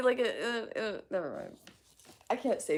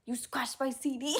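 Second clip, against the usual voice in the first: raised put-on character voice; movie line impression; 6 semitones higher than usual. Streamer quoting a movie to chat, higher put-on voice. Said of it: "does he know the movie reference?".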